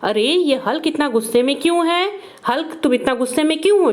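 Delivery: ordinary talk, nothing marked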